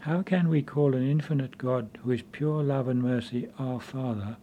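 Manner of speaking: ordinary delivery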